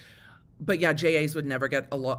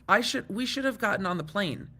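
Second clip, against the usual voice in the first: ordinary delivery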